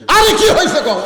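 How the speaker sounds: high, loud, insistent question; exasperated demand. Acted. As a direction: burst out demanding an answer from a tearful wife. Husband asks again, losing patience. (অধৈর্য হয়ে চড়া গলায় জিজ্ঞাসা)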